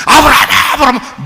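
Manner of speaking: loud urgent call across distance. Acted. God shouting to Abraham to stop. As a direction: shout the name to stop him.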